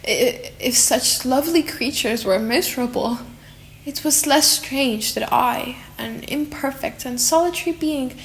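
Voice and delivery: usual speaking voice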